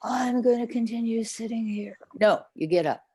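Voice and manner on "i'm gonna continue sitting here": breathy near-whisper of a smug meditator; mock blissed-out meditator. Meditator mock-whispering about being in samadhi, hammed-up. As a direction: gush softly over your own bliss, hushed. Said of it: "if you feel that this meditation is so wonderful".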